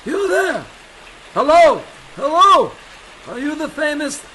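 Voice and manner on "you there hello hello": shouted greeting across the field; calling out. Acted. Airfield worker calls out to the arriving aviator, loud. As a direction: hail them from a distance.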